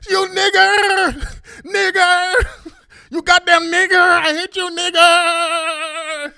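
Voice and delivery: very high, loud, drawn-out shriek; hammed-up hysterical yelling, words stretched out; mock screaming. Roasted opponents screaming back at him, mock hysterical yelling. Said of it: "they're screaming and yelling".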